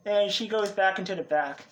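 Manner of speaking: normal talking voice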